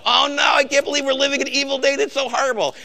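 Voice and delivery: high, whiny hand-wringing; mock despair. Believer wringing hands over evil days, mock whiny despair. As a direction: ham up a frightened believer. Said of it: "He doesn't go".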